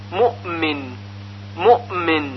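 مؤمن as mu'min, hamza easing into the m; reciters' hamza. Shown as a demonstration. Teacher showing how most reciters say it, near-qalqalah hamza in مؤمن. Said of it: "به همین صورت که تلفظ شد".

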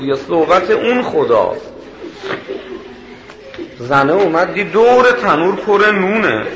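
high, loud preacher's cry, slowing on the last lines; fervent preaching. Acted. Preacher proclaims God's promise to the crowd, loud and high. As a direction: lift the voice and proclaim to the congregation.

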